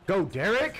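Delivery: high, loud cheer with a rising end; urgent shout. Real, not acted. Player urging Derek on, urgent shout. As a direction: yell the runner on. Urging runner, louder repeat.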